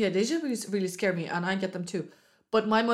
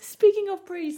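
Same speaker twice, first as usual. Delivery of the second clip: high, louder sing-song tease; teasing; 11 semitones higher, 6 dB louder, slower than usual. Acted. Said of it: "teasing topic change".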